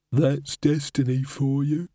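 slow, dry hollow voice; hollow skull voice. Acted. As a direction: drawl like a bored old skull. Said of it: "said the skull".

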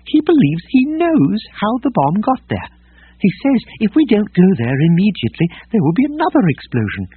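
high, prim, agitated droid voice; fussy protocol droid. Acted. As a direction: fret in a high prissy robot voice.

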